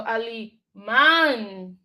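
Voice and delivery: alemán with the final "án" drawn out long; stress on the accented A. Shown as a demonstration. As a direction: lean slowly on the last syllable of alemán. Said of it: "I know this emphasis on A".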